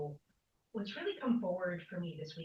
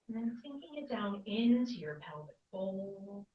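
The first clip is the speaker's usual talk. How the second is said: guided meditation. Real, not acted. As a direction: draw the words out, leaving room to breathe.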